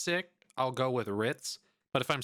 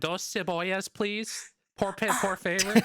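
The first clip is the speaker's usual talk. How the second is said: higher, halting gringo Spanish; awkward gringo Spanish, pitch climbing on please; gringo Spanish accent; 7 semitones higher than usual. Retold or imitated. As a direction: order in clumsy American-accented Spanish. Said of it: "I speak Spanish and I sound like".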